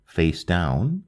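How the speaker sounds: ordinary delivery, nothing marked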